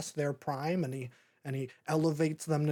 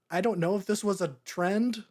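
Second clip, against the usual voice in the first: ordinary talk, nothing marked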